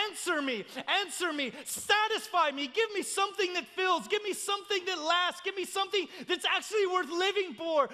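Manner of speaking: high, urgent pleading cry. Acted. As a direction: demand it from the gods, phrase on phrase. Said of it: "has cried out to the gods".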